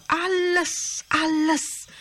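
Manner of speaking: each alles lifted high and drawn out; emphatic repetition. Real, not acted. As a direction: lean hard into every alles. Preaching climax on giving everything.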